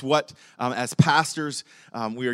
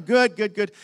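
nervous reply; 7 semitones higher, 6 dB louder than usual. Retold or imitated. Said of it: "and I'm nervous".

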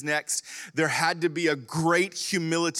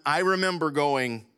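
usual speaking voice

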